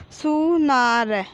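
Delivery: slow, stretched सु, ना, र, falling at the end; drawn-out teaching reading of सुनार; syllable by syllable. Shown as a demonstration. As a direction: sound out सुनार piece by piece. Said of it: "तो हम इस प्रकार पढ़ेंगे".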